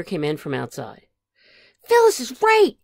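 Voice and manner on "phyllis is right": high, loud outburst; alarmed announcement. A narrator's reading. Phil Junior comes in from outside, alarmed and loud. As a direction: burst in with urgent news.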